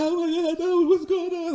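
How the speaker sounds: high-pitched imitation of a panicked wail; mock crying. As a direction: wail it, exaggerating your own crying. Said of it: "and I'm crying".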